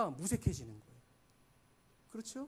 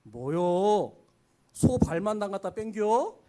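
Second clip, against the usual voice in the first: louder Chungcheong jab, rising on 뺑겨; Chungcheong dialect; 5 dB louder, slower than usual. Retold or imitated. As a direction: complain about bland soup, country style. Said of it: "갈비탕이 맛없을 때 하는 말".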